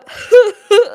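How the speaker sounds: mock the actor's famous laugh; Seth Rogen laugh. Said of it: "Imagine that laugh".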